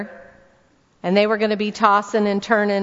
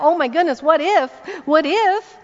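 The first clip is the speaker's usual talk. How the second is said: mock anxious worry; 6 semitones higher than usual. Imitating anxious night worry.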